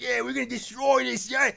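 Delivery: high, loud, rowdy biker voice; villain voice. Retold or imitated. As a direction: yell it like a gleeful wrecking crew. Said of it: "They would just come out and be like".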